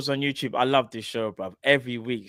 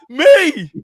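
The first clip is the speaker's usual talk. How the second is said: mock innocent; 9 semitones higher, 9 dB louder than usual. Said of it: "You're like".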